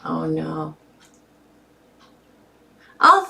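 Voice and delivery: ordinary delivery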